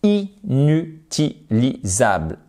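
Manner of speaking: "inutilisable" slowly broken into separate syllables; emphatic syllable splitting. Real, not acted. Teacher warning learners about bad accent, "inutilisable" syllable by syllable. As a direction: spell out "inutilisable" beat by beat for emphasis. Teacher hammers home a point.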